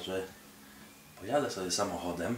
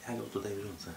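ordinary talk, nothing marked

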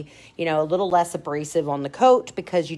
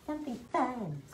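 higher-pitched, wishy-washy client voice; typical client; 8 semitones higher than usual. Retold or imitated. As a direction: imitate a vague client in a raised voice. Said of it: "Most clients are just like".

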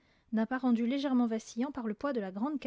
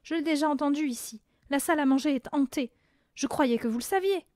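louder, raised-pitch insistence; insistent; 4 semitones higher, 6 dB louder than usual. A narrator's reading. Mathilda convincing family of a ghost, insistent. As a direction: press the point firmly. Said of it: "insista Mathilda".

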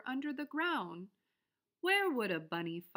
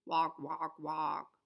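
low croaky frog; 5 semitones lower, slower than usual. A narrator's reading. Frog answering the bunny, low croak. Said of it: "sang the frog".

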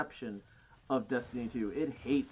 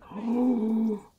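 sharp excited gasp; 8 semitones higher, 8 dB louder than usual. Real, not acted. Spots chance to send blocker.

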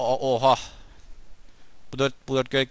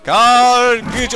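loud, higher put-on cry of the name; mock call; 8 semitones higher, 8 dB louder, much slower than usual. Gamer mockingly calls out the crow-hunter, loud. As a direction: call it out like a taunting herald. Calling out an enemy unit.

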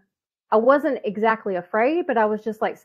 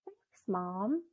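very soft, muted aside; thinking to herself; 5 dB quieter, much slower than usual. Retold or imitated. Daughter silently answering her fretting mom, hushed aside. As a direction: say it small, as an inward reply. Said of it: "thinking to myself".

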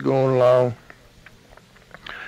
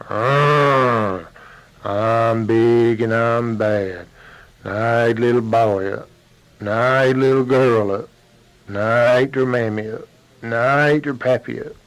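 big bad bear; 2 semitones lower, 3 dB louder than usual. Bear threatens the monkey.

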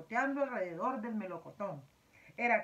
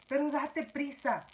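louder, hurried insistence; impatient urging; 5 semitones higher, 5 dB louder, slower than usual. A narrator's reading. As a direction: hurry him up, insistently. Centipede urges James on.

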